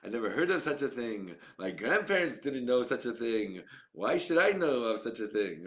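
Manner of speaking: put-on a grandparent's old-world Yiddish lilt, lightly done; Yiddish accent. A rabbi shrugging off veganism in a lightly mocking Yiddish accent. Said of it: "you put on your, like, Yiddish accent".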